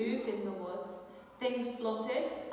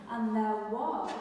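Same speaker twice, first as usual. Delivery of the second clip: usual speaking voice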